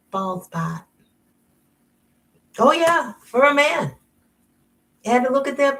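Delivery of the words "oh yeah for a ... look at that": high, loud sassy admission; cheeky yes-I-did; knowing confession. Real, not acted. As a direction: own it with a knowing lift. Admits doing it for a man.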